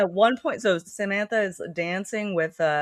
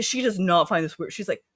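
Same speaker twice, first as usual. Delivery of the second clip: ordinary delivery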